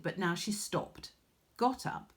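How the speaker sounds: plain reading voice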